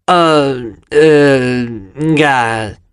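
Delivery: tongue-tied ah, eh, nga; dumbstruck stammer. A narrator's reading. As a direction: stumble out sounds, lost for words. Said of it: "dissi qualcosa tipo".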